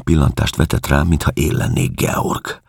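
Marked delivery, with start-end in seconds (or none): none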